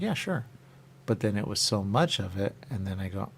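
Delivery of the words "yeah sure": high eager yes. Retold or imitated. Accepting a pretzel sample.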